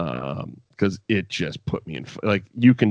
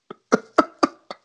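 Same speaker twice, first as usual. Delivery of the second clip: airy, wheezing laugh; real laughter; breathless laugh. Real, not acted. Laughing after punchline.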